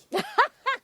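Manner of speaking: a burst of high laughter; bright laugh. Real, not acted. Laughs at her client's remark.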